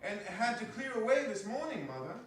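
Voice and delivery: a daughter explaining herself; young Martha. Acted. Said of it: "replied the girl".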